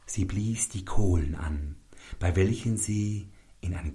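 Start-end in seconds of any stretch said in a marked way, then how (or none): none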